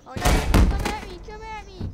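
very loud, high challenge; shouted taunt. Acted. Opponent answering the dare, shouted taunt. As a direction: yell the dare right back at him.

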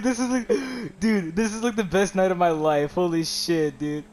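ordinary talk

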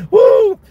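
loud, high-pitched "woo"; high whoop. Real, not acted. Preacher catching himself after acting out a classroom meltdown, whooping. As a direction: let out a sudden high whoop.

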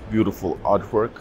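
ordinary talk, nothing marked

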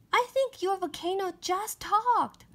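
high, certain princess. Acted. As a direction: insist with wide-eyed certainty. Princess insists.

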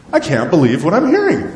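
incredulous God. Acted. God hears his people's excuses, incredulous. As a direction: voice God shaking his head. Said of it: "I'd only imagine just God going".

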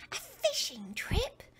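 high, slow thrilled question; light up at the idea; excited. Acted. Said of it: "cried Nathan".

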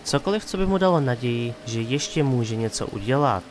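usual speaking voice

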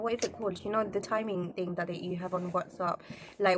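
ordinary delivery

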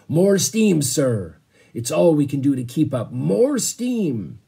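loud, high bellow down a tube. Acted. Captain bellows to the engine room, loud bellowing. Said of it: "unhooked the brass voice tube and bellowed".

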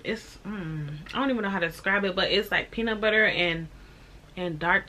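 chewing while talking; talking between bites; mouth full of doughnut. Real, not acted. Seen 3: Holds doughnut at lips, bites and chews throughout the labelled words.

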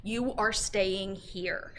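slow, spaced-out firm words to her ex; firm slow retort. Retold or imitated. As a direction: lay down the law slowly, word by word. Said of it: "You know what I told him?".

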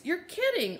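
ordinary delivery

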